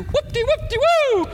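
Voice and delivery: very high, loud sing-song whoop; hammed-up whoop-de-woo; mock preacher whoop. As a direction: ham up a showy preacher's cry. Mocking a showy preacher.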